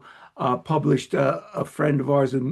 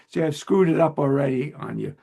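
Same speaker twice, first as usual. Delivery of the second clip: ordinary talk, nothing marked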